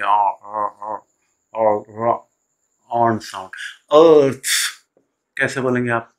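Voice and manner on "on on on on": "on" said slowly, repeated with an open rounded "aw" vowel; the aw sound demo. Shown as a demonstration. Teacher drilling the vowel so students remember it. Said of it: "ये याद रखिए".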